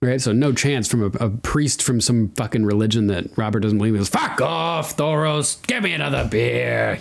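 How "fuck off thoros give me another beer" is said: Robert's gruff dismissal, pitched up on fuck off; drunk king brushing off. Retold or imitated. Drunk king waving off the priest for a beer, mock gruff. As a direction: bark it like a drunk king who wants his beer.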